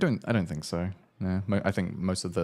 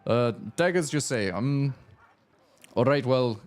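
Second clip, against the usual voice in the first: raised-pitch shopkeeper with mock Russian accent; pleased smith in a put-on Russian accent; attempted Russian accent; 4 semitones higher than usual. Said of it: "I'm trying to do a Russian accent".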